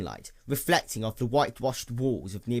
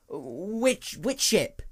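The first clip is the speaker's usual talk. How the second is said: nervous stammer pitched higher; stumbling voice; 7 semitones higher, much slower than usual. A narrator's reading. Frightened clerk asking the stranger which ship, stumbling. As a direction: trip over the first word, then push on. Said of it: "His voice stumbled a bit".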